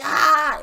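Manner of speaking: high, loud crowd "Ah!"; imitated crowd reaction; mock crowd gasp. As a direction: shout out the crowd's shock. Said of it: "everyone was like".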